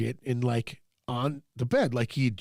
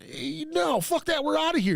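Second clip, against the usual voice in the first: higher, indignant quoted outburst; mock fed up; 13 semitones higher than usual. Telling everyone they're leaving the Airbnb, higher mock indignation. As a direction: pitch it up like you're done with this place. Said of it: "And I'm just going".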